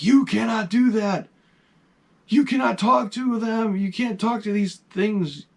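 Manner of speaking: mother and sister, panicked, forbid talking to the ghost; alarmed mother. Retold or imitated. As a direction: imitate frightened family forbidding it. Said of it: "and they go berserk".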